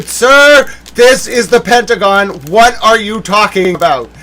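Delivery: loud, mock-incredulous switchboard operator; exasperated operator. Pentagon operator to a clueless caller, loudly exasperated. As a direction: set the caller straight, hammed-up disbelief.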